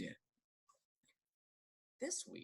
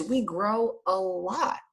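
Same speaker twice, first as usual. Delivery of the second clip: slow, higher, drawn out; emphatic; 8 semitones higher, much slower than usual. Acted. Teacher telling kids how much they grow, slow and higher. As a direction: stretch out grow a lot.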